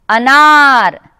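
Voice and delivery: ordinary talk, nothing marked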